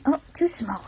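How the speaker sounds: ordinary talk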